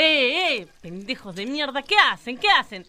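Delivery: loud scolding yell; angry scolding. Acted. Pocha catching kids misbehaving, loud angry scolding. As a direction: tell the kids off hard.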